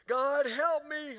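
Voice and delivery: high, loud, slow plea for help; desperate cry. Retold or imitated. Preacher mocking a desperate churchgoer, high loud plea. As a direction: cry out to God in mock despair.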